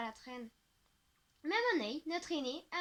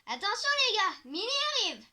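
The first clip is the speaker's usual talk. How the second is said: higher and louder, mock warning; teasing call-out; 6 semitones higher, 9 dB louder than usual. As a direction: announce her arrival like a herald. Teasing a friend at the table.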